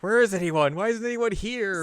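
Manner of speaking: high, frantic calling out; panicked calling. Retold or imitated. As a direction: call for help in a panic. Acted frantic search.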